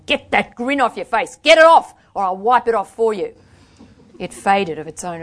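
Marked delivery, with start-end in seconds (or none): 0.1-3.3 loud angry command, peaking in a shout; losing self-control. A narrator's reading. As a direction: order them furiously to stop. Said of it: "last of my self-control gave way".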